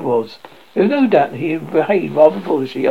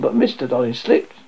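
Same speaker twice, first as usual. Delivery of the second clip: usual speaking voice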